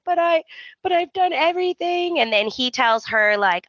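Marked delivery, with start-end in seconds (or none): 0.0-2.2 high, whiny protest; hammed-up hurt wife; mock pleading. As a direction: plead in a put-on high whine. Retold tearful wife pleading.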